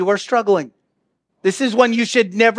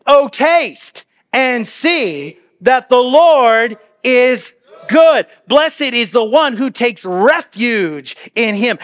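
proclaimed scripture; 3 semitones higher, slower than usual. Acted. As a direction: proclaim it slowly, rising with joy. Preacher proclaiming favorite psalm verses.

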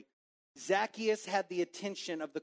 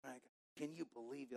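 softer muttered complaint; muttered gossip, much softer; 4 semitones lower, 15 dB quieter, faster than usual. Shown as a demonstration. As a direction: grumble it just loud enough to spread. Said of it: "saying it just loud enough".

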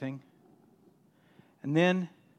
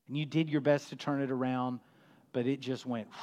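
usual speaking voice

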